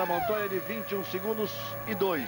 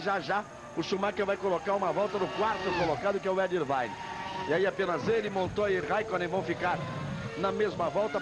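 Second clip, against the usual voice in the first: live race play-by-play; brisk broadcast lap-by-lap call; motorsport commentator; 3 semitones higher than usual. Real, not acted.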